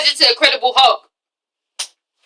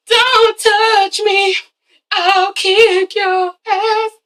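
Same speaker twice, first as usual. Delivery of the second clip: high, drawn-out singsong threat; mock threat; 6 semitones higher, much slower than usual. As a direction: stretch every word high, hamming it up. Repeats the imagined threat for laughs.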